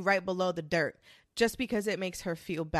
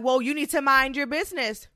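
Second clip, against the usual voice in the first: mock Olive snapping; 3 semitones higher, 7 dB louder than usual. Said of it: "Olive will be like".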